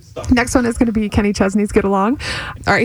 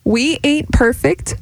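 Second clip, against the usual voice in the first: slower; slower than usual. Shown as a demonstration. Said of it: "All right, slower".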